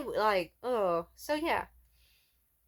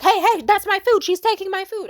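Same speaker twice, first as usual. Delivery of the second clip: loud high outraged shout; angry yell; 12 semitones higher, 15 dB louder than usual. Retold or imitated. Customer yelling at the girl taking his food, loud high outraged shout. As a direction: yell that she's stealing your food.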